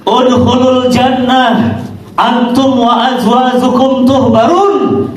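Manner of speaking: slow, measured recitation; Quran recitation. Acted. Preacher recites the verse, slow and chanted. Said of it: "Allah katakan apa?".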